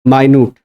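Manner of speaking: long vowels in माइन्यूट; "my-NYOOT" pronunciation. Shown as a demonstration. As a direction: model माइन्यूट on its own as "my-nyoot". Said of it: "इसी को आप माइन्यूट बोलते हो".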